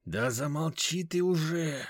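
slow hissed rebuke; hissing. Acted. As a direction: hiss it into his ear. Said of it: "зашипел мне на ухо веник".

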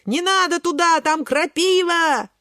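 high holler, dragged at the end; wailing warning. A narrator's reading. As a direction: wail the warning, stretching it out. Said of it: "голосит Огурчик".

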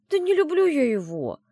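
high, loud, defensive protest; sulky denial. A narrator's reading. Heroine denying love to her friend, sulky protest. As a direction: deny it with a sulk. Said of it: "насупилась я".

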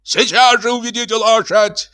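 shrieky, very high demand; frantic high-pitched official; hysterical shouting. A narrator's reading. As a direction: order it out hysterically at the top of the voice. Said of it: "истерически кричал управдом".

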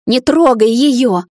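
slow, forceful angry order; hissed warning. A narrator's reading. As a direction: warn them off slowly and fiercely. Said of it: "зашипела".

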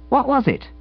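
high, eager schoolboy voice; cheeky boy scout. A narrator's reading. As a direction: play the smug little scout, pleased with himself. Narrator voices Edwin.

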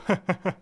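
high, hearty ha-ha-ha; joyful laugh. A narrator's reading. Scrooge long out of practice, laughing joyfully. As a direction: laugh out loud with delight. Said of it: "Це й справді був чудовий сміх".

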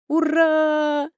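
loud happy whoop; excited cheer. Acted. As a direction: cheer it out, delighted. Friend cheering, clapping hands.

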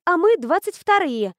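high, loud, stress on мы; eager, stressing мы; excited. Acted. Excited take.

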